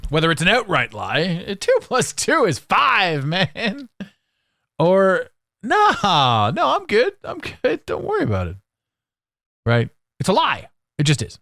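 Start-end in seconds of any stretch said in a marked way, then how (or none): 1.6-3.9 slow, high mock fib; put-on high liar voice; high-pitched lying. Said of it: "talks in a high-pitched voice". 5.6-8.6 mock high brush-off; falsely breezy high voice; high-pitched lying. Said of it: "talks in a high-pitched voice".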